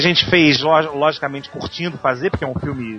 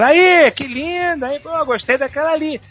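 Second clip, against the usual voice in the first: put-on high slow leering teen voice; catcalling teens; 11 semitones higher, 3 dB louder than usual. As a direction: drawl it like a teen eyeing a girl. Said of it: "aquele vozerio".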